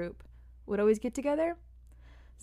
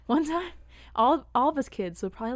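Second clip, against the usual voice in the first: ordinary talk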